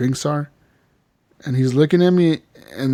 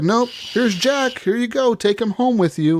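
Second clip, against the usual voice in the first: slow, offhand, higher voice of the Italian host; casual Bronte voice; 9 semitones higher, slower than usual. Retold or imitated. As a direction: hand the boy over with mock nonchalance. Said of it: "And the dude's just like".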